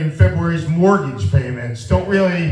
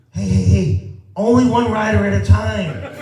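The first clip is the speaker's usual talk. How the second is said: higher, laughing mock instructor; lightly voiced fitness instructor; 4 semitones higher than usual. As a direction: call it out like a bossy trainer. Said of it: "the instructor comes on and looks at me and says".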